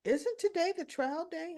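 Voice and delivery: high, querying retold disbelief; puzzled challenge. Stella questions T J in disbelief.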